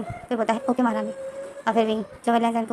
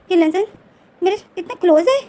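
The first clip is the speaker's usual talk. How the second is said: startled, higher and louder; shocked thought; 7 semitones higher, 8 dB louder than usual. Retold or imitated. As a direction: blurt it out in sudden shock. Wing wakes shocked beside Lanjan. (चौंककर, ऊँची आवाज़ में)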